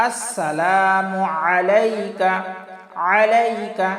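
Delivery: আলাইকা opened with squeezed mid-throat ayn; pressed ayn. Shown as a demonstration. Teacher demonstrates ayn, আলাইকা pressed from mid-throat. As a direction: chant slowly, pressing the first sound of আলাইকা. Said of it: "চাপ দিয়ে উচ্চারণ করব".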